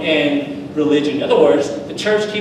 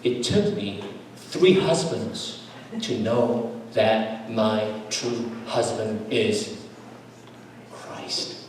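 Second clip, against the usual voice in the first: slow, soft, fading to a whisper on Christ; quoting a dying woman; 5 semitones lower, 8 dB quieter, much slower than usual. Retold or imitated. Dying church sister telling pastor her true husband. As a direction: let it drift down to a breath. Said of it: "I'm quoting her".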